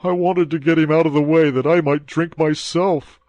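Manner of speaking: a hint of a servant shaking with fear; terrified. A narrator's reading. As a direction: blurt it out in fright. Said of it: "whose teeth chattered with terror".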